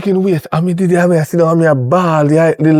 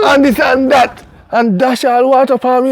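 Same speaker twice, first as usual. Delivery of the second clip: mock yelling; 6 semitones higher than usual. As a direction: recount her yelling back in your voice. Woman yelling at her.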